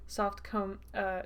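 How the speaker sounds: ordinary delivery, nothing marked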